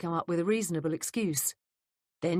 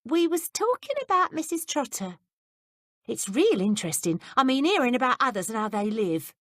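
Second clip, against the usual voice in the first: lightly voiced Cockney maid, dropped H's; a hint of Cockney, dropping her H's; mock Cockney maid voice, higher; 7 semitones higher, 4 dB louder than usual. A narrator's reading. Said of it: "She deliberately began dropping her H's".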